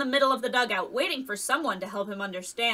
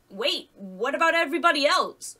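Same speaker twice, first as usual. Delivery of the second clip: higher, louder urgent protest; 4 semitones higher, 5 dB louder than usual. A narrator's reading. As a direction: break in, alarmed for the others left behind. Alex alarmed for teammates left behind.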